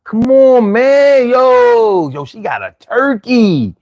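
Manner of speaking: Philly drawl, words dragged long; high, slow, stretched-out Philly talk; drawn-out Philly accent. Shown as a demonstration. Showing viewers how to talk Philly again, dragged-out Philly accent. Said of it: "drag my words out a lot".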